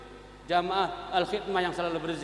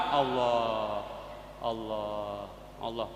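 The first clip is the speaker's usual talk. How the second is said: much lower and softer, fading Allah; fading dhikr; 8 semitones lower, 8 dB quieter, much slower than usual. Retold or imitated. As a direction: let each Allah die away to a breath. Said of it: "ya dikecilkan". (zikir makin pelan dan lirih)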